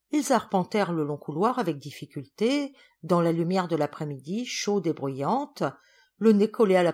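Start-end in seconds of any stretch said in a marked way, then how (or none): none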